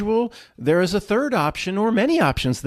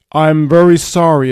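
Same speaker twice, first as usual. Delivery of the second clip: booming voice; 2 semitones lower, 6 dB louder than usual. Acted. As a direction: boom the apology like a greater power. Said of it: "it says in its booming voice".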